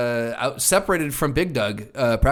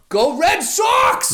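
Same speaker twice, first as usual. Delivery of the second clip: high, slow stretched Boston fan shout; fan cheer; 4 dB louder, much slower than usual. Pretend. Boston fan cheering the team, slow high shout. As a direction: cheer it out long.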